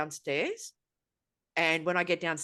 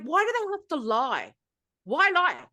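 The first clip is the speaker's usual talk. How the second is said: high-pitched plea, louder on the repeat; exasperated disbelief; 9 semitones higher, 5 dB louder than usual. Real, not acted. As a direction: demand an answer, pitch and volume climbing. Asks in exasperation why they lie.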